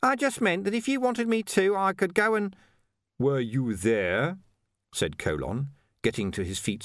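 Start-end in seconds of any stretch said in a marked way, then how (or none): 0.0-2.5 much higher, hesitant and faltering; faltering Ping. Acted. Ping stammers. 3.2-4.4 very slow, pointed demand; stern. Acted. Colon rounds on Ping.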